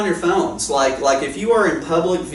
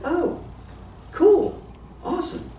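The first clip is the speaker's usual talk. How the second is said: polite surprise; 5 dB quieter than usual. Retold or imitated. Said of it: "I said".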